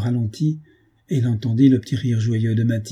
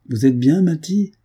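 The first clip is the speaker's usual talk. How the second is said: louder, rising call as if across the road; 6 semitones higher, 4 dB louder than usual. A narrator's reading. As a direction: call out "Vous êtes bien, Matty?" with a higher lift on Matty. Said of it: "lui cria-t-il".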